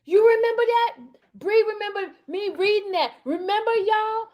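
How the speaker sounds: high-pitched excited squeal; real excitement. Real, not acted. Reminding Bree and y'all of the reading, excited. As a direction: keep the voice right up high. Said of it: "I got a little bit excited".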